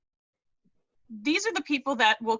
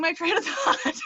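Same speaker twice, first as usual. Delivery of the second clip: higher voice breaking into laughter; embarrassed laughing; 8 semitones higher than usual. Real, not acted. Speaker losing her place mid-testimonial, laughing at herself. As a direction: let the line dissolve into a laugh.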